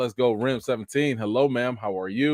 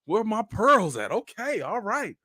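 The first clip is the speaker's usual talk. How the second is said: mock scandalized; 9 semitones higher, slower than usual. Pretending to clutch pearls at the scene. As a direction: play prim shock for laughs. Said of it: "So I was like".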